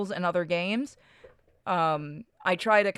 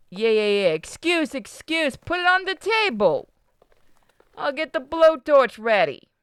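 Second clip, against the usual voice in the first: high-pitched, impatient Brooklyn boss; hammed-up New York mobster giving orders; Italian New Yorker accent; 7 semitones higher, 3 dB louder than usual. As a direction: brush him off like a New York wiseguy. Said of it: "fucking Italian New Yorker".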